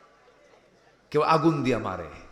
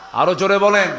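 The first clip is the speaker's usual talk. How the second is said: raised, higher call; loud urging; 4 semitones higher, 8 dB louder than usual. Real, not acted. Preacher calling for a louder answer, loud urging. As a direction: press the crowd to answer up.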